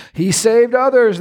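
high sneering scoff. Acted. Priests scoffing at Jesus on the cross, high sneer. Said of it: "they scoffed".

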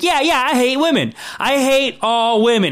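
mock agreement, dragged out high; sarcastic Kevin Hart. Kevin Hart's sarcastic reply.

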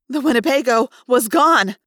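plain reading voice

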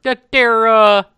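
loud, hammed-up Wisconsin dad accent. Imitating a Wisconsin dad, loud Wisconsin accent. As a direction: do the small-town Wisconsin dad. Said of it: "the only accent I'm really good at".

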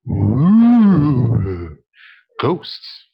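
airy spooky "ooh, ghosts"; ghost impression. Shown as a demonstration. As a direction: moan it like a ghost. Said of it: "That was my ghost impression".

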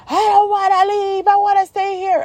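very high, wailing mock tantrum; whining child. Daughter refuses to leave the park, tearful mock whine. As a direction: imitate a kid wailing in protest.

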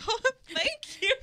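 high, loud giggly thank-you; mock gushing thanks with a giggle; flattered gushing. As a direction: play it as flustered and delighted, gushing.

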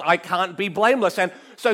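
ordinary talk

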